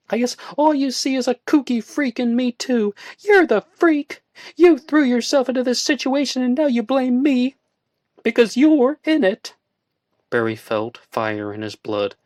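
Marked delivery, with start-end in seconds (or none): 0.1-9.5 roaring. A narrator's reading. Woman roaring at her friend in anger. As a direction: roar it at him. Joanna's angry tirade.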